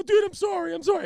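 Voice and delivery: high, loud sobbing apology; crying. Retold or imitated. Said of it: "I cry, start crying again".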